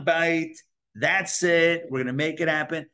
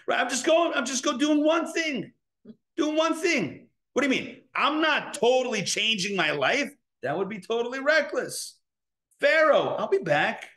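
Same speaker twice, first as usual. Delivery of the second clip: higher, light mock-innocent bargaining; 7 semitones higher than usual. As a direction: sweet-talk your inner Pharaoh. Bargaining voice to Pharaoh.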